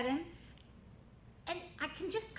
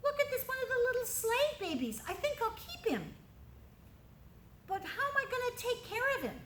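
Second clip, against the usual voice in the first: put-on higher, lilting young princess voice; 11 semitones higher than usual. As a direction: voice the delighted princess finding a baby. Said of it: "And the princess says".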